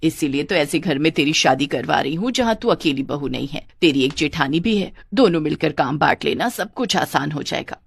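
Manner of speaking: low, reassuring motherly voice; caring mother. Acted. (दिलासा देती माँ की नीची आवाज़)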